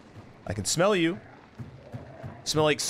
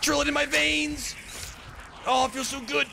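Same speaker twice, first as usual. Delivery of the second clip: hammed-up cry; 8 semitones higher than usual. Gamer crying out how good it feels, high hammed-up wail. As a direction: overact it in a raised pitch. Overacted reaction during game.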